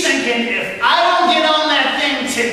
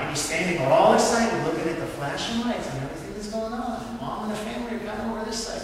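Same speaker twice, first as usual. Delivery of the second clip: usual speaking voice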